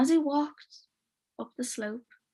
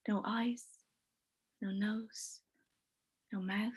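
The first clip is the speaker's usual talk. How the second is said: much softer, whispered reveal; eerie whispered ending; hushed ghostly climax; 9 dB quieter than usual. A narrator's reading. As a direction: let it fade into a whisper.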